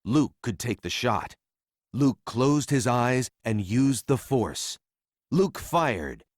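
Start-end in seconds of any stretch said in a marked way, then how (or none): none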